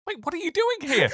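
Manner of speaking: high, surprised question; mock alarmed surprise; startled. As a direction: jump in alarm. Startled reaction.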